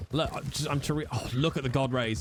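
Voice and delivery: normal talking voice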